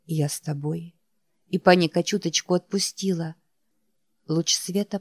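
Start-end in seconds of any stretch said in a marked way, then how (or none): 0.1-0.9 soft inner whisper; quiet, comforting whisper. A narrator's reading. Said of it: "Что-то шепнуло мне".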